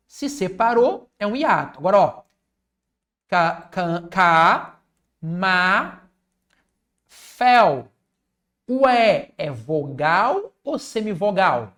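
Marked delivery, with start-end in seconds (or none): 3.3-7.9 ca- ma- fel broken into separate beats; syllable split. Shown as a demonstration. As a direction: chop the word into syllables. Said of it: "splitting camafeu in syllables". (palavra dividida em sílabas)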